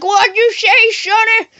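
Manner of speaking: put-on hollering high-pitched old-timer; old man. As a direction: shout it like a hard-of-hearing grandpa. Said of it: "like, this old guy".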